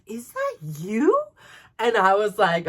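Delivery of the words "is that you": slow, louder question, pitched up at the end; mock disbelief, drawn out; retold double take. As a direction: imitate a stunned double take. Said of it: "she did, like, a double take".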